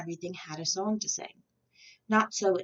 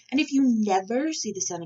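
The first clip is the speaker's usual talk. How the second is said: snarling; 4 semitones higher, 6 dB louder than usual. A narrator's reading. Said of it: "snarled the mayor".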